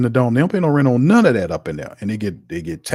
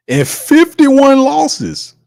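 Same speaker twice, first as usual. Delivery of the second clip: stretched out, much higher and louder; mock incredulous; 6 dB louder, much slower than usual. Host mocking the team's record, stretched high disbelief. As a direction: drag it out in disbelief.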